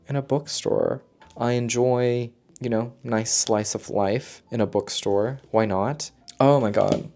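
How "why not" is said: ordinary talk, nothing marked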